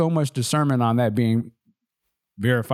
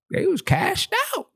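high, slow, amazed exclamation. Retold or imitated. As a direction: marvel at how good they had it. Amazed at workers' perks.

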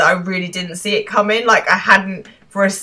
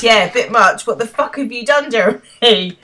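higher, rising mock outrage; outraged; 4 semitones higher, 4 dB louder than usual. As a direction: build to an exasperated demand. Acted outraged confrontation.

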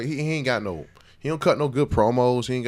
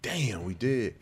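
usual speaking voice

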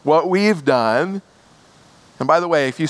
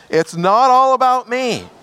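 louder call; 7 semitones higher, 4 dB louder than usual. Shown as a demonstration. Preacher urging the church to repeat it louder. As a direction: say it higher and louder, with force. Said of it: "Try it again".

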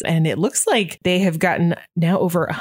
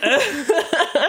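high laugh; 10 semitones higher than usual. Real, not acted. Laughs at own joke.

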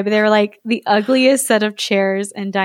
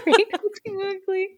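high, wailing exclamation; wailing Vizier; 10 semitones higher, 6 dB quieter than usual. Acted. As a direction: wail it like a frantic courtier. Continuing Vizier quote.

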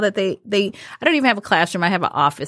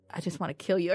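low threat; 4 semitones lower, 11 dB quieter, faster than usual. Retold or imitated. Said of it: "very low".

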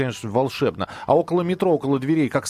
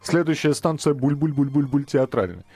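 бульканье вместо слов, как диктор метро; пародия на булькающее объявление в вагоне; drinking from a fountain. Retold or imitated. (булькающий голос диктора метро)

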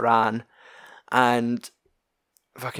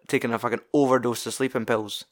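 ordinary talk

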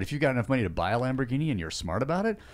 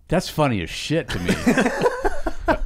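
laughing; 3 semitones higher, much slower than usual. Real, not acted. Laughing at a story.

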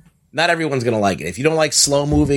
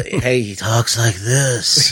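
Dream voice; 2 semitones lower, much slower than usual. Retold or imitated. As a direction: drawl it like Dream from Sandman. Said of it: "like, the Dream voice around the house".

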